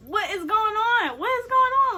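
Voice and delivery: high, loud disbelieving outburst; exasperated. Retold or imitated. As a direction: demand it in baffled frustration. Retelling her exasperated reaction.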